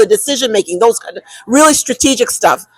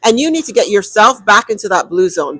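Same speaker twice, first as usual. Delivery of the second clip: ordinary talk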